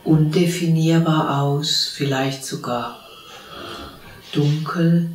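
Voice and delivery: calm, unhurried meditation voice with long pauses; guided meditation. Real, not acted. (ruhige Stimme einer geführten Meditation, mit langen Pausen)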